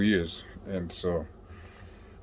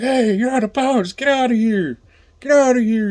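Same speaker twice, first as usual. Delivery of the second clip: high, louder mock guard shout ordering him out; guard yelling; 5 dB louder than usual. Guard yells at an inmate out of bounds, mock high shout. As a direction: bark it like a prison guard clearing the area.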